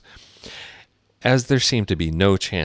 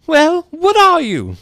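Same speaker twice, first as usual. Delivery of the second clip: very high, loud challenge; put-on accusing bird voice; demanding pigeon; 10 dB louder, slower than usual. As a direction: screech the question slowly and pointedly.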